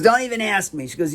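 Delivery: high-pitched imitated brush-off; dismissive receptionist. Receptionist asked how to get to Kaufman, high dismissive imitation.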